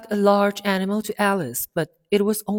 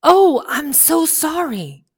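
higher, louder, slow cry of apology; cried apology; 9 semitones higher, 8 dB louder, slower than usual. A narrator's reading. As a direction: call out sorry to the startled mouse. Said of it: "cried Alice quickly".

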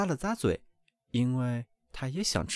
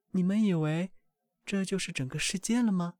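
lightly voiced mother duck, a bit higher; gently amused mother teasing her young; a hint of a mother duck; 7 semitones higher than usual. A narrator's reading. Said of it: "鸭妈妈说道".

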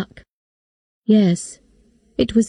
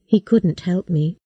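plain reading voice

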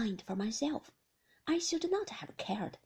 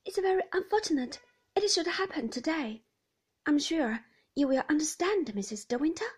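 flustered nurse; 6 semitones higher, 5 dB louder than usual. Acted. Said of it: "rather pink and flustered".

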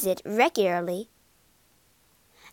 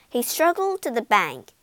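usual speaking voice

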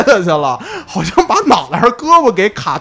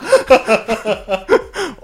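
rising burst of laughter; 6 semitones higher than usual. Real, not acted. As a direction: laugh at the mishap. Laughing at tooth flying out.

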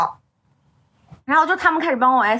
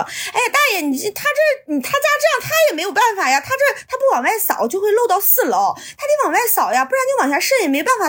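much higher-pitched, placating appeal; imitated pleading persuasion; 7 semitones higher than usual. Upstairs owner talking the old man down, imitated pleading. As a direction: coax him to back off, in mimicry. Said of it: "那个房主就开始往外劝他说".

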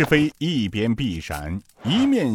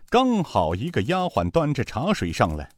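ordinary delivery

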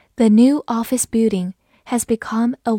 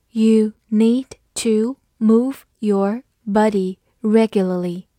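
slow, each word set apart; slow read-through; 4 dB louder, slower than usual. Shown as a demonstration. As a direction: model it slowly, word by word. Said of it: "好，慢读一遍". (慢读一遍，一词一顿)

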